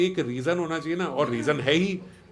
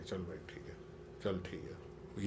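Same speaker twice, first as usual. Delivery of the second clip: soft, slow, swallowing the insult; quiet resigned acceptance; 8 semitones lower, 18 dB quieter, slower than usual. Retold or imitated. After being insulted at the office, mumbling quiet slow acceptance. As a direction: let it go under your breath, slowly.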